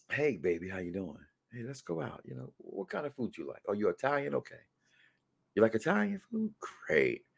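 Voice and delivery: low, soft crooning date voice; smooth pickup line. Pretend. Host playing a guy sweet-talking Anissa on a date, low and smooth.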